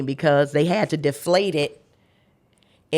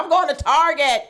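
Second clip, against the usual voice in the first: laughing while saying it, pitched up; 11 semitones higher than usual. Retold or imitated. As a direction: toss it off with a laugh. Joking answer to the nurse.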